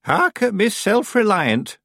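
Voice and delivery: slow, high teasing; gentle mockery. A narrator's reading. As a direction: tease without real nastiness, drawn out and high. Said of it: "not in a particularly nasty way".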